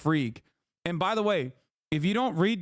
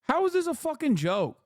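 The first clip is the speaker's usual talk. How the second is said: outraged; 8 semitones higher than usual. Real, not acted. Outraged rant at Elon.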